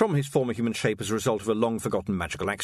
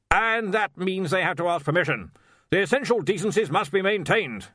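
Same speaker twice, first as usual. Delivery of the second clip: firm, loud bluster; 8 semitones higher, 4 dB louder than usual. Acted. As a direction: lay down the law firmly. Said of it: "said Ridcully firmly".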